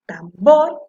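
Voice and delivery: tambor building to a loud BOR; stressed last syllable. Shown as a demonstration. Teacher proving the word is aguda, tambor with BOR stressed. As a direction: start tambor low, punch the final bor. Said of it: "bor suena la última con más fuerza".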